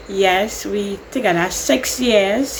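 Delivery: ordinary delivery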